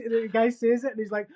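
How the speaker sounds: ordinary talk, nothing marked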